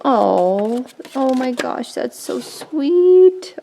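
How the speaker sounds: high, drawn-out touched coo. Real, not acted. To a generous giver of paints, deeply touched. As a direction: melt with gratitude, stretching "sweet".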